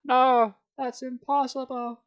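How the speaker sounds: hammed-up disbelief; horrified denial. A narrator's reading. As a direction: plead against the truth. Denial in horror.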